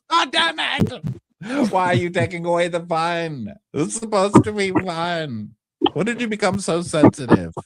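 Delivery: put-on petulant protest; mock exasperation. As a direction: complain like a thwarted bully, hammed up. Acting out a frustrated complaint.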